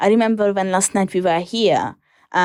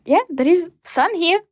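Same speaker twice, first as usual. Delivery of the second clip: mock naive; 8 semitones higher than usual. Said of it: "I was like".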